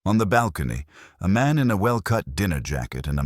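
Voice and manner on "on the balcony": ordinary delivery, nothing marked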